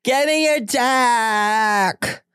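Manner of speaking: put-on high, very slow, sloppy party-girl whine; drunk bachelorette. Mocking a rowdy bachelorette heckler at a drag show, high and sloppy. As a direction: ham up a drunk hen-party heckler pawing at the queen. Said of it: "bachelorette party hoe who's like".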